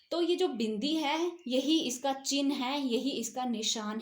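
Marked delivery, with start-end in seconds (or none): none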